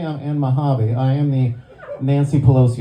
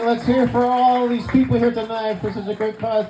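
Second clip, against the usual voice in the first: big raised announcer shout-out; crowd hype-up; 6 semitones higher than usual. Real, not acted. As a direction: pump up the room like a host. Emcee hyping crowd at event.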